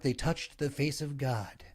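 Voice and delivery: slow, soft presidential impression; hushed, stately oratory; Reagan. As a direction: say it slowly and softly, like a solemn speech. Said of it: "they could all do Reagan".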